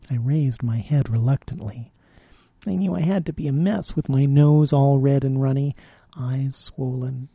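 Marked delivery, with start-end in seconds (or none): none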